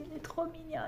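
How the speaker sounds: high, soft gushing over something cute. Real, not acted. Crafter cooing over her new cute pattern, high and soft. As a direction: coo over how adorable it is.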